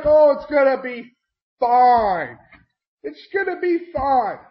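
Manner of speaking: mock reassurance. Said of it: "But everybody's like".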